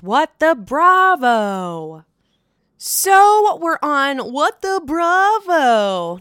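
slow, drawn-out announcer voice; segment title announce. Acted. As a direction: call the segment name like a show host.

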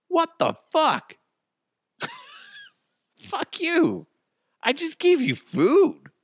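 high-pitched, incredulous outrage; acted offended protest; indignant outburst. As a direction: squeal it in exasperated disbelief. Acted outrage at ungrateful man.

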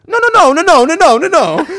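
high, loud, frantic yell of no; panicked yelling. Retold or imitated. Scammer hears the word cops and yells in a high, loud panic. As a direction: scream no over and over in a panic. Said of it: "he starts yelling".

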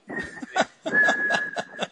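high, thin sustained whistle; imitated hearing-aid whistle. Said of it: "it whistles all the time. Like".

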